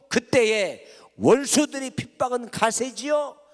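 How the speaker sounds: loud and driving from the pulpit; fervent preaching. Acted. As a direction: push the story up to a climax. Preacher builds Luther's struggle.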